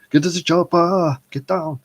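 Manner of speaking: loud, high mock action-hero yell; Arnold. Vlogger copying the impressionist's film star, loud straining shout. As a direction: holler it out as a hammed-up action star. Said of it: "like, Arnold".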